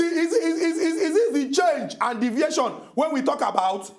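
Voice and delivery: rising from firm to high, agitated rebuke; heated scolding. Acted. Host rebukes co-host for dodging the question, heated scolding. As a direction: scold with mounting pitch and pace.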